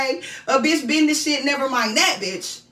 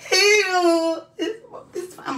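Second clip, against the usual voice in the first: crying; 5 semitones higher, slower than usual. Pretend. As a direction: voice breaking into a sob. Mother role breaks into crying.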